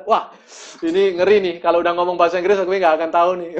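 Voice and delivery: mock panic. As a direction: blurt it out like you've suddenly lost your nerve. Panic on meeting an American. (panik pura-pura, cepat dan keras)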